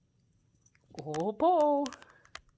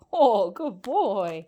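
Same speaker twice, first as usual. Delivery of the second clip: drawn-out excited praise; 12 dB louder, much slower than usual. Real, not acted. Praising bird after it smashes.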